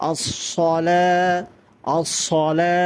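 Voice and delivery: deliberate আসসালা, long last vowel; correct sad. Shown as a demonstration. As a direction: round the s of আসসালা like as-sola. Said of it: "এই জন্য এখানে সদ হবে".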